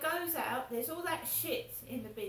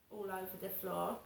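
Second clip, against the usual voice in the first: ordinary talk, nothing marked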